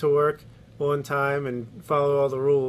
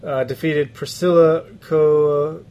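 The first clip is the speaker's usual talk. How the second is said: ordinary talk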